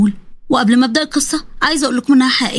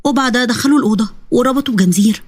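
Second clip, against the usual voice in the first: plain reading voice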